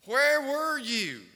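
put-on high, loud, slow wail of a lost child; drawn-out tearful child's question; crying little boy. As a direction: cry it out like a frightened boy who was found. Crying son found in store.

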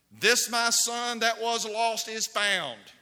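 high, loud and slow, every word weighted; sermon proclamation, drawn out; proclaiming scripture. Retold or imitated. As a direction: preach it like a father overjoyed. Quoting the prodigal father.

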